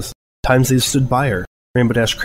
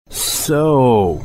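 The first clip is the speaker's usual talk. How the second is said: drawn-out word. A narrator's reading. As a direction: stretch out So slowly. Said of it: "Rainbow drew the word out".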